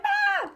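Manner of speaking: short, very high yelp; mock shriek. Coach mimics a paralyzed seller, high mock shriek. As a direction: squeal like you've frozen up.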